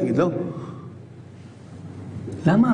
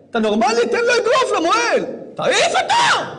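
mock indignant yell; 13 semitones higher than usual. Rabbi mocking what a rational father would shout at the mohel. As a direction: holler it like an outraged skeptic.

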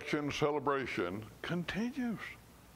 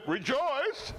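much higher, bright sung-out greeting; cheerful greeting; 11 semitones higher than usual. Shown as a demonstration. Preacher demonstrating rejoice as a greeting, high and bright. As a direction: call it out like a happy hello. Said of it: "Instead of saying goodbye".

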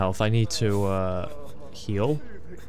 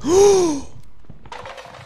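startled gasp. Real, not acted. Player startled while hiding in horror game, sharp high gasp. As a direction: gasp in sudden fright.

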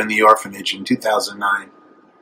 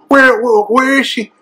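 frantic searching; 12 semitones higher, 4 dB louder than usual. Retold or imitated. Hears girl calling Papi.

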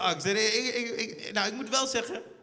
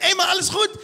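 hoog en luid roepen met een lach; vrolijke straatgroet; 10 semitones higher, 8 dB louder than usual. Retold or imitated. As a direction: enthousiast begroeten, veel hoger. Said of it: "Hij zegt". (vrolijke mede-allochtoon roept hoog en luid)

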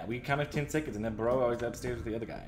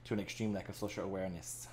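story narration; 2 semitones lower, 5 dB quieter than usual. Acted. Reads game narration.

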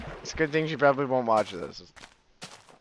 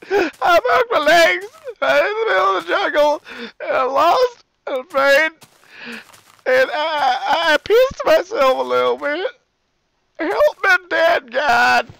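higher, louder, crying mock terror; hammed-up panic; 14 semitones higher, 6 dB louder than usual. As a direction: wail like a lost kid in the jungle. Pretend lost, hurt in jungle.

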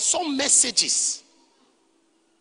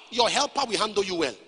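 ordinary talk, nothing marked

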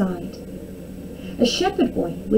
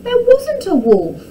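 high, loud indignant protest; angry shout; 7 dB louder than usual. A narrator's reading. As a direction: shout the denial like an outraged boy. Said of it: "Tom shouted angrily".

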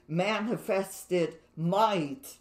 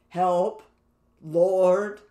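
"help" and "Lord" each lifted out louder on a rising tune; emphasized key words; 5 dB louder than usual. Shown as a demonstration. Preacher stressing the key words help and Lord, louder. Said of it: "each verse emphasized the words".

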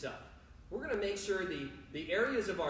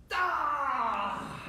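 long, high, falling "ah"; mock dismayed cry; 6 semitones higher, 3 dB louder than usual. Coach catching kids out again, mock dismayed cry. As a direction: let out a drawn-out pretend wail. Playful groan after the trick call.

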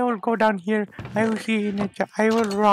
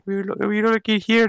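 ordinary talk

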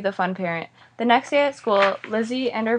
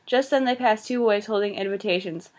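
ordinary delivery, nothing marked

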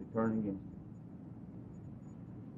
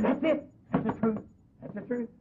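pitched higher, eager agreement; bursting to agree, voice up high; excited retelling; 9 semitones higher than usual. Retold or imitated. Pastor eagerly confirming to the preacher, pitched higher.

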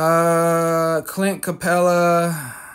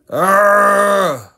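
loud, higher frustrated arrgh; frustrated growl; 6 semitones higher, 8 dB louder than usual. Real, not acted. Opening a card pack, missing the wanted card, loud frustrated groan. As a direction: let out an angry groan of frustration.

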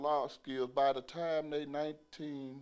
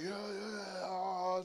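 higher, hyped-up inmates; excited crowd; 4 semitones higher, much slower than usual. Retold or imitated. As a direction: egg the fight on. Inmates egging on fight.

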